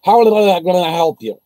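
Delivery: imitating a polite but useless agent; mock ticket agent. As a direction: play the chirpy phone agent. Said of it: "the girl goes".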